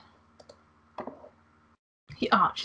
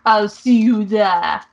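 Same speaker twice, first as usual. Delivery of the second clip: slow, louder mock French; French accent; 7 dB louder, slower than usual. Angel says goodbye to the player in a slow French accent. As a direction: drawl the farewell with a French lilt. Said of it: "the accents dipping in and out".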